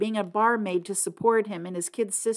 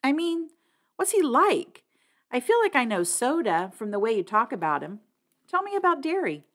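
lightly voiced teenage girl, higher; girl character voice; 6 semitones higher than usual. A narrator's reading. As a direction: coax him gently to talk, higher pitch. Girl's voice in story.

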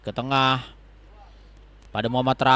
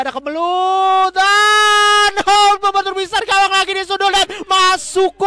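goal call; 5 dB louder than usual. Real, not acted. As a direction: scream the scramble into the goal. Scramble, goal scored.

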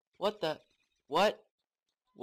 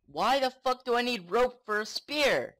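loud, high-pitched indignation; incredulous rant at the game; exasperated outburst; 7 semitones higher, 6 dB louder than usual. Real, not acted. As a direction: complain loudly, voice pitched up.

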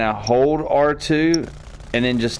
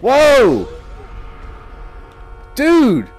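loud, high yelp of surprise; shocked yell; startled shout; 11 semitones higher, 4 dB louder than usual. Real, not acted. Sudden in-game shock.

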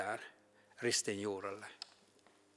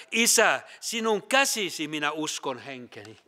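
loud cry; 2 semitones higher, 4 dB louder than usual. Retold or imitated. Preacher quoting Jesus's last prayer on the cross, loud cry. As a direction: call out the first word, then settle into solemn quoting. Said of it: "Jeesus huusi kovalla äänellä".

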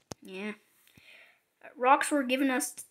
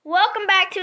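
excited greeting; 8 semitones higher, 10 dB louder than usual. Real, not acted. Loud vlog greeting.

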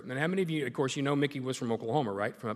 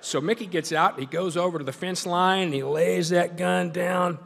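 raised, louder storyteller voice; building story climax; 6 semitones higher, 7 dB louder than usual. Retold or imitated. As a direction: drive it up louder toward the gunshot. Building up to the gunshot.

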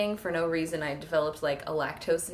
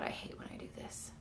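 whispering; 17 dB quieter than usual. Real, not acted. Annoyed at losing her thread.

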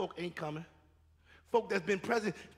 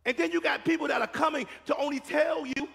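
raised, higher preaching cadence; full-force preaching; 6 semitones higher, 4 dB louder than usual. Acted. Preacher pressing the church on excuses, fervent preaching. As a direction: preach it hard to the congregation.

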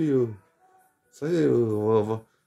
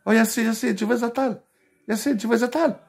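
mock North African immigrant accent, pitched up; imitating his friend's thick blédard accent calling out; 9 semitones higher than usual. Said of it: "sa voix de blédard". (imitation moqueuse de l'accent blédard, voix plus aiguë)

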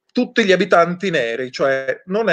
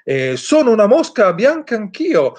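higher, proud exclamation of a pink elephant; pink elephant exclaims; 7 semitones higher, 3 dB louder than usual. A narrator's reading. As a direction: announce it as a pompous elephant. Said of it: "esclamò un elefante rosa".

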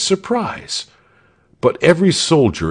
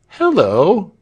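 put-on high, louder boy's hello; sudden exclamation; 9 semitones higher, 4 dB louder than usual. A narrator's reading. Walter spots something odd, sudden high exclamation. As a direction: call out in sudden surprise. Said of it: "said Walter suddenly".

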